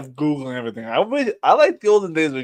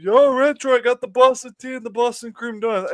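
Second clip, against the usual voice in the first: high, louder hyped-up imitation of the friend; friend's excited greeting; 9 semitones higher, 3 dB louder than usual. Friend from Boston bragging about donuts, high hyped mimic. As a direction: playfully mimic a buddy bragging about treats. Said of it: "he's like".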